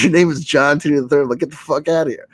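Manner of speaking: can't believe the name you just heard; incredulous. Retold or imitated. Incredulous at the time traveller's name.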